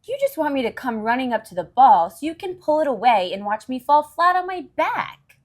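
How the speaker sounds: loud protest. Acted. Charlie Brown accuses Lucy of tricking him, loud and fast. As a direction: fire it off fast, suspicious and loud.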